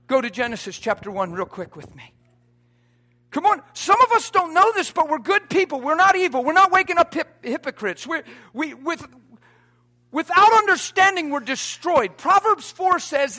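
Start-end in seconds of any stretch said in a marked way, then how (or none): 3.3-12.2 loud, driving preacher's build; full-force preaching. Acted. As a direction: preach it hard to the back row.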